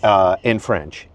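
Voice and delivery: normal talking voice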